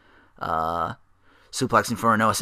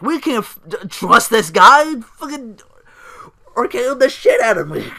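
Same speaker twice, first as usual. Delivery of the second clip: much higher, hammed-up furious rant; pissed off Jamie Noble; 11 semitones higher, 8 dB louder than usual. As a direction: rant like a betrayed hothead. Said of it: "he's fucking pissed off".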